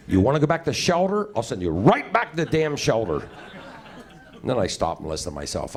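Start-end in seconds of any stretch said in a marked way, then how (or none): 0.1-3.3 higher mock threat to the cat; threatening the cat. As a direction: scold the cat with a raised, put-on voice. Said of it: "talking out loud at home to my cat".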